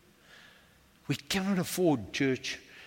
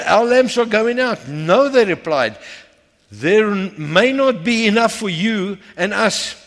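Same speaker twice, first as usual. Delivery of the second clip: loud, raised preacher's delivery; forceful preaching; 6 semitones higher, 8 dB louder than usual. Acted. Preacher telling the church of the foolish and wise virgins, forceful preaching. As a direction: drive the story home from the pulpit.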